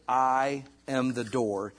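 "I am the door" drawn out slowly; slow it right down to land it; slow emphatic quote. Acted. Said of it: "I really wanna put the emphasis on".

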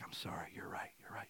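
barely audible imitation of someone giving in; hushed, sheepish concession; whispered apology. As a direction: whisper it meekly, quoting a chastened person. Voices the pharmacist giving in.

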